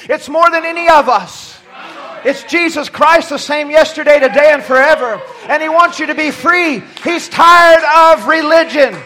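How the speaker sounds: impassioned pulpit proclamation; full-force preaching. Acted. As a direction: drive each repeated phrase home to the congregation.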